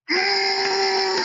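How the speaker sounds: tearful high whine; high crying whimper. Real, not acted. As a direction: let out a high, loud choked cry. Moved by the anime quote.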